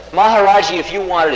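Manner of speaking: put-on devoted young man blurting out his offer; eager offer. Young follower eagerly offers the car.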